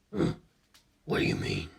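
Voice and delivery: calm inhuman curiosity; cold ancient being. A narrator's reading. Cars voice.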